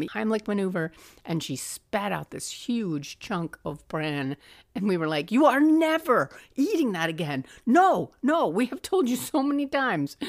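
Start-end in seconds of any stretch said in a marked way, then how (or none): 5.3-10.2 high, loud mock scolding; exasperated scolding. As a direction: tell mom off, half laughing, half shocked. Family scolding mom after choking.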